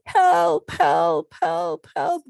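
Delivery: loud cries for help, fading; calling for help. Acted. Teacher voicing Sally calling for help, loud. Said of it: "And she goes".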